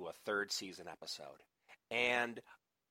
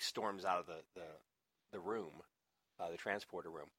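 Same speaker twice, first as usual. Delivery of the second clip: ordinary talk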